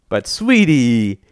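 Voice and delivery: syrupy fatherly wheedle; cajoling. A narrator's reading. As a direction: stretch it out pleadingly. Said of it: "he cajoled".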